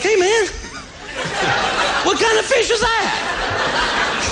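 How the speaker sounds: mocking fishing buddy. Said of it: "your friends would be like".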